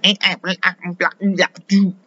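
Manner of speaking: high mocking blah blah; high, mocking babble of a churchgoing lady; mocking gibberish. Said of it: "she comes up to me like".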